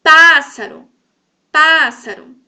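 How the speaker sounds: PÁssaro, first syllable hit very loud; teacher's demo of PÁssaro with a booming first syllable; stress on PÁ. Shown as a demonstration. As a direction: punch PÁ, let ssaro fall away. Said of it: "o pá, ele faz parte".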